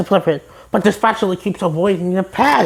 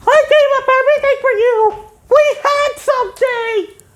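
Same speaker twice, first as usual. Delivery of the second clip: much higher, wailing plea; melodramatic SpongeBob. Retold or imitated. SpongeBob wailing at the spatula, high and melodramatic. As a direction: wail it like a soap breakup.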